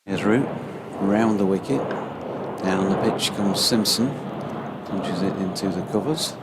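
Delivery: live cricket commentary. Acted. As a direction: build the call as the batsman charges. Radio cricket ball-by-ball.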